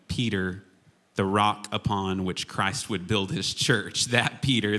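normal talking voice

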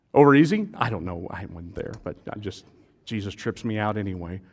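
ordinary talk

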